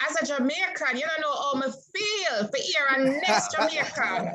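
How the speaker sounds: switches into her Jamaican patois; Jamaican accent. Real, not acted. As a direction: speak it in a Jamaican accent, lightly. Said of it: "let me go back into my British accent now".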